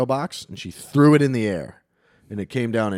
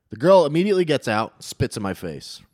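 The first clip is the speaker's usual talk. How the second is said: ordinary talk, nothing marked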